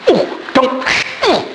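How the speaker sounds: startled yelp. Real, not acted. Cat bites speaker mid-sermon.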